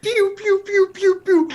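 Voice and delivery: high, loud play-gun pews; pew noises. Pretend. Imitates shots or siren in scene.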